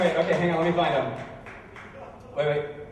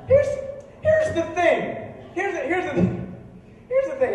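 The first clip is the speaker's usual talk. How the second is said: high, stammering exasperation; acted sputtering, too baffled to continue; flustered disbelief; 11 semitones higher than usual. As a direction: keep restarting, pitched up in disbelief. Flustered reply to absurd question.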